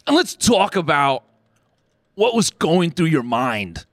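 high, pushy mock-host voice; podcast host invitation. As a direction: pitch the show like a hyped-up host. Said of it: "They were like".

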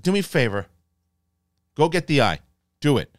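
insistent retold demand; acted urgent order. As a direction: order it done, lightly acted. Acting out Alicent's order.

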